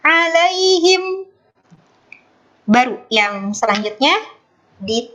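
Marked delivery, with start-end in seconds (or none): 0.0-1.3 alaihim held high, not resolved; suspended ending. Shown as a demonstration. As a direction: hang the word alaihim up high. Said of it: "Alaihim digantung".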